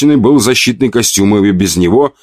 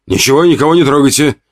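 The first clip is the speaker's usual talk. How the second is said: higher, fast alarmed shout; 7 semitones higher, faster than usual. Acted. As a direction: cry it out in alarm. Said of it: "вскрикнул врач".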